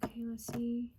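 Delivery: breathy whisper to herself. Real, not acted. Medium whispering to herself mid-reading, softly whispered.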